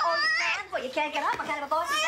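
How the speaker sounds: high, loud mocking challenge; loud taunting. Therapist mocks child who claims she's boss, loud taunting. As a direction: taunt over the child's protests.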